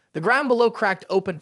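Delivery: ordinary delivery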